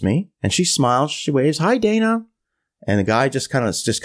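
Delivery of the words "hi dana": imitating her higher-pitched hello; cheery greeting. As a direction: sing out a friendly hi as her. She waves, greeting him.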